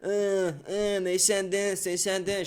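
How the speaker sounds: high, moaning imitation; mock whining. Imitating people who complain about words, mock whining. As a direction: whine like a petty complainer, mockingly. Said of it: "bitch and moan and complain and whine".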